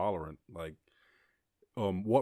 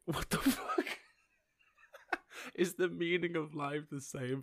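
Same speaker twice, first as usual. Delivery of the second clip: breathy laugh, much higher, almost no voice; laughing disbelief; 8 semitones higher than usual. Real, not acted. Laughing at the odd question.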